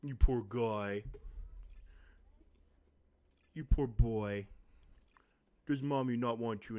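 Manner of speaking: slow sympathetic coo; pitying the dog. Real, not acted. Comforting the upset dog.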